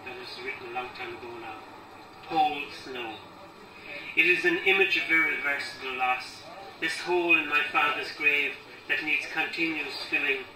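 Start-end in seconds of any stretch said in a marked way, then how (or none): 2.3-10.4 deliberate recital, pressing on key words; poem reading. Acted. Poet reading about a father's grave, firm deliberate recital. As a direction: recite the verse firmly, pausing between phrases.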